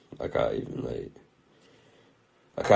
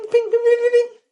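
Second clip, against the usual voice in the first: very high, loud twanging pings; imitated wire ping; 10 dB louder than usual. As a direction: pluck each ping like a snapped wire. Imitates braces wire snapping.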